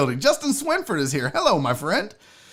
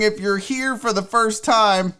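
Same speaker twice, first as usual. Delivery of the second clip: ordinary delivery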